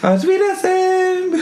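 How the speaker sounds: high, drawn-out mock German; German accent. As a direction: stretch it out in a hammed-up German accent. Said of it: "Do it in German".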